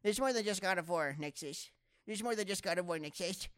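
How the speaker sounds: high-pitched mock complaint; mocking Cool Me. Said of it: "That's what he sounds like".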